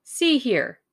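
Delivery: loud, high warning opener; suspicious challenge. A narrator's reading. Hersheimer growing suspicious of his two visitors, challenging. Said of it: "seemed to grow suddenly suspicious".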